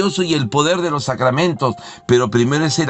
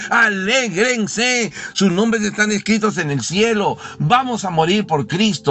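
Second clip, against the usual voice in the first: much higher, slow, ringing call; joyful proclamation; 7 semitones higher, slower than usual. Acted. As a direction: announce it with radiant joy. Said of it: "pero es con alegría".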